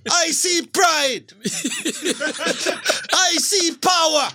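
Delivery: slow, proud self-affirmation chant. Pretend. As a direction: declare it like a mirror mantra. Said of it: "repeat after me".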